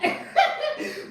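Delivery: breathy high laugh; airy laugh. Real, not acted. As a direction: laugh under your breath. Laughing at grandma story.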